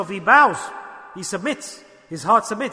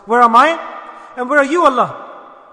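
servant crying out to Allah; 5 semitones higher, 10 dB louder than usual. Acted. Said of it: "He says".

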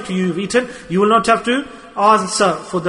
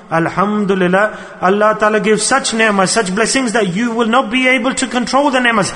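ordinary talk, nothing marked

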